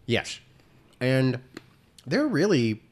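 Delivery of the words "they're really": talking while crunching ice; chewing ice. Real, not acted. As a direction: mouth full of ice. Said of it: "I'm chewing on ice".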